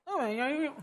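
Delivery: lightly put-on radio presenter talk; lightly mocking on-air chatter. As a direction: imitate an affected radio host in passing. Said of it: "because some people go".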